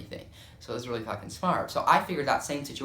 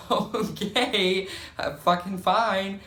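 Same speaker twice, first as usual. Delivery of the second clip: high, drawn-out okay; taken-aback retreat; 7 semitones higher, much slower than usual. Retold or imitated. Daughter backing off from snapping mom, taken aback. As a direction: back off in a high, slow voice. Backing off after mom's outburst.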